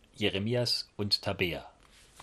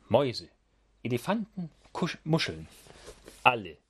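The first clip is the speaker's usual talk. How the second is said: lively picture-book read-aloud; reading to children; 2 semitones higher than usual. A narrator's reading. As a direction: tell it like bedtime for little ones. (lebhaft vorgelesene Kindergeschichte)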